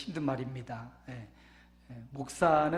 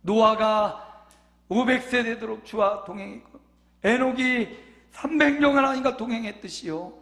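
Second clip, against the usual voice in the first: louder, higher ringing sermon voice; full-force preaching; 7 semitones higher, 9 dB louder than usual. Acted. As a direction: proclaim the promise to the whole church. Preaching builds again. (힘차게 외치는 설교)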